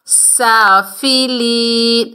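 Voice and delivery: سافلین with long ا and ی; slow recitation. Shown as a demonstration. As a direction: draw out سافلین slowly. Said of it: "ایک الف کی مقدار کھینچ". (سافلین کھینچ کر)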